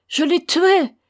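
mother's peak of grief, screamed; anguished scream. A narrator's reading. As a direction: scream it to the sky. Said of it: "criait-elle".

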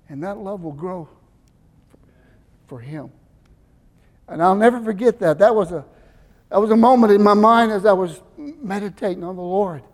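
ordinary delivery

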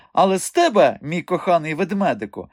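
high-pitched mocking mimicry; Madame Buchenbach. A narrator's reading. Man mimicking the directress to the strongman, mocking imitation. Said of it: "перекривив він мадам Бухенбах".

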